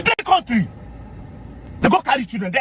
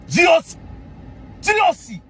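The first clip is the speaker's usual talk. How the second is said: mocking call; 9 semitones higher than usual. Real, not acted. Mockingly calling the general's title.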